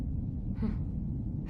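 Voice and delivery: short soft laugh; a quiet huff of a laugh; soft breathy laugh. Acted. Laughs during the interview.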